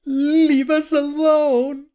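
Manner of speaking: very high, drawn-out frightened cry; frightened scream. A narrator's reading. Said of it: "Taylor screamed in fright".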